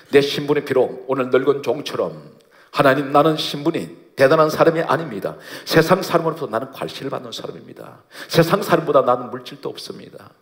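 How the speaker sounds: impassioned preacher praying aloud; fervent prayer. Acted. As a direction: cry out in earnest prayer. Models a humble servant's prayer aloud.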